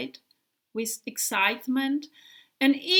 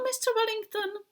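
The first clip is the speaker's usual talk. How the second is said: high, quick question; little volunteer asking high and quick; high eager question; 11 semitones higher, faster than usual. Acted. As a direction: squeak up eagerly.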